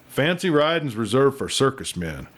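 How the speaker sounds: ordinary delivery, nothing marked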